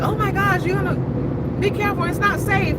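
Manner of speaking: high-pitched mimicked fretting; worried friends. People warning about moving to Dubai, mimicked worry. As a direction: imitate anxious friends warning you.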